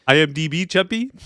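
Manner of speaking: put-on high, loud teasing South African accent; mock South African accent. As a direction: tease him in a hammy South African accent. Mocking friend's accent.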